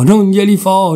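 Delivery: stubborn angry repeat; defiant refusal. Acted.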